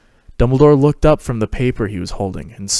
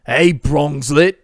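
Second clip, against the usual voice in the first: slow, higher, louder delighted call; cheerful baby greeting; 5 semitones higher, 5 dB louder, slower than usual. A narrator's reading. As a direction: coo loudly to a toddler. Sirius greets baby Harry.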